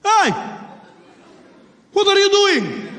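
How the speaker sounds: high, loud challenge; confronting shout. Acted. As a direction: call him out sharply at full voice. Passenger confronts man with vest.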